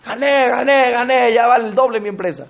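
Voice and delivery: high, repeated victory boast; mock triumph. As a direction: imitate an overexcited winner. Acting a fan boasting of winnings.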